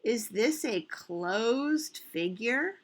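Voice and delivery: drawn-out question, rising at the end; slow pondering question. Real, not acted. Teacher repeating the closed figure question for children, slow and questioning. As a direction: pose it slowly, leaving room to think.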